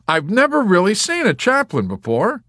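high, loud, grinning amazement; fascinated delight. A narrator's reading. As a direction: marvel out loud through a big grin. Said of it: "stared at him with a big, fascinated grin".

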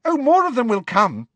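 breezy certainty; confident. A narrator's reading. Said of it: "said Ginny confidently".

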